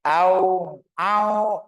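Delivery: high, loud, blocked nasal AU; wrong, honking AU through the nose; nose held. Shown as a demonstration. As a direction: pinch the nose and say AU. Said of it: "Sekarang pegang hidung".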